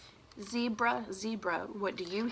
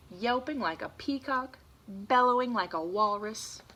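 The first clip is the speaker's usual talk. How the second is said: louder, building storybook list; reading to children; 5 dB louder than usual. Acted. Teacher reading the book's big finale to kids. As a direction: read the animal list with gusto.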